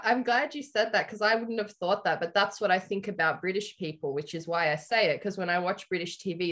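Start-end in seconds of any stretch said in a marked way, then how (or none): none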